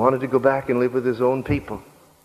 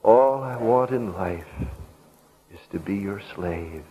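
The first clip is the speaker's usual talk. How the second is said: tearful devotion; 3 semitones lower, 4 dB quieter than usual. A narrator's reading. Freed woman offering her life to her buyer, tearful and hushed. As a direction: sink lower and softer, phrase by phrase. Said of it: "through her tears she looked up".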